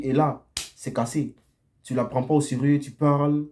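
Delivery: ordinary delivery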